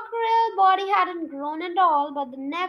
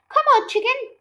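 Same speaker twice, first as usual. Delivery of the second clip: coaxing call; 8 semitones higher, 7 dB louder than usual. A narrator's reading. Coaxing the chicken.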